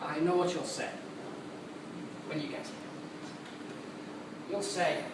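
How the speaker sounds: low, soft, halting; hushed, weary anticipation; subdued resignation. Acted. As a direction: come down quiet after the rant. Subdued after the rant.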